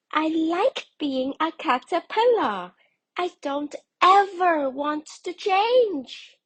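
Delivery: high, loud, stubborn little caterpillar; childlike high voice refusing to change. Acted. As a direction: dig in your heels, small and insistent.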